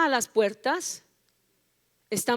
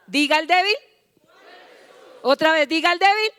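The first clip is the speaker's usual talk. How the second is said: high, loud prompt rising at the end; rallying call; 6 semitones higher, 7 dB louder than usual. Real, not acted. Preacher urging the congregation to repeat the declaration, rallying. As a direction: call it out for everyone to answer.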